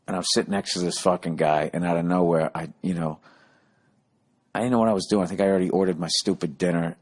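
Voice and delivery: ordinary talk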